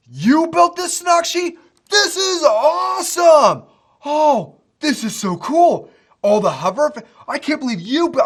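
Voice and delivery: stunned, thrilled shout, slowing down; can't believe what they're seeing; excited. Real, not acted. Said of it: "I need to calm down".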